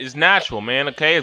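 usual speaking voice

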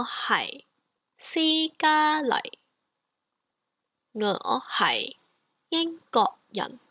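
slow, careful model sentence; slow model phrase. Shown as a demonstration. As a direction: say each syllable deliberately. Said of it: "Let's go through that again".

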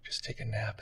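hushed, almost whispered invitation to rest; soft soothing inner voice. Retold or imitated. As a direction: coax yourself to sleep, very softly. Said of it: "incredible soft sensation of just".

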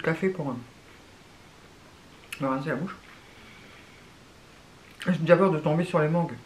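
speaking with liquid in mouth; pausing to sip drink; talking between sips. Real, not acted. Seen 2: Lifts iced coffee, sips through straw mid-phrase, then speaks holding cup.

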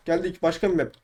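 ordinary talk

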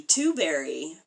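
ordinary delivery

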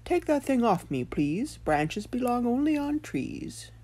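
high put-on stone voice, slowing down; prim high-voiced talking stone. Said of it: "for the stone said".

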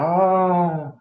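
high, loud mock fighting cry; imitated battle yell. Game master mimicking the player punching, mock battle cry. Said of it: "You're like, "Ah," as you're punching him".

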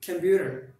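computer with the t tapped, compuder; flap T. Shown as a demonstration. Teacher demonstrating computer with a flap T. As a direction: computer said with a quick d-like tap. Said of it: "we pronounce it like".

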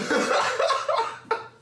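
loud, high, wheezing laugh; helpless laughing fit. Real, not acted. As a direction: crack up with hardly any voice. Breaking up over the spice.

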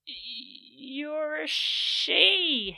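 slow, loud disbelieving blurt; stunned. A narrator's reading. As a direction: let the surprise stretch out.

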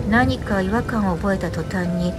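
ordinary delivery, nothing marked

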